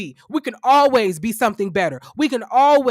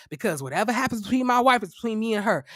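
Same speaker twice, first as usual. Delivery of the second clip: ordinary talk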